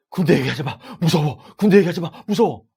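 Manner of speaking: loud, hammed-up panicked plea; acted trembling fear. Man haunted by army memories begging friends to stop, acted panic. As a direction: play someone terrified, pitch climbing as you beg. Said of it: "갑자기 벌벌 떨면서".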